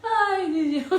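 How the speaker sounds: high, loud exclamation through laughter; laughing. Real, not acted. Reader exclaiming in Spanish, laughing. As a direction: gasp it out mid-giggle.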